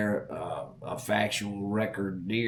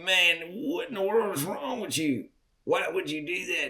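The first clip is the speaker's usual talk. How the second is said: higher, sassy scolding; cheeky; 7 semitones higher than usual. Retold or imitated. Teen sassing the old hunter, cheeky and higher. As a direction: sass the old timer. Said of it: "I got cheeky and I'm like".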